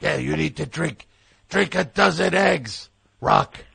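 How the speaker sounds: put-on gruff old trainer voice; old boxing coach giving orders; Mickey voice. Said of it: "Mickey, the old trainer".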